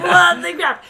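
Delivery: high, loud, laughing disbelief; shocked outburst. Retold or imitated. Friend retells reaction to the fake Australian, shocked. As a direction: shriek it in mock outrage, laughing.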